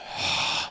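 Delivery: weary breathy sigh from the skull; an old skull sighing; skull's sigh. Acted. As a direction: let out a long tired sigh. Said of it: "The skull sighed".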